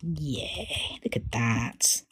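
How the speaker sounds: low, slow whisper of admiration; hushed awe at the painting; awed whisper. Real, not acted. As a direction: breathe it out in wonder. Admiring the result.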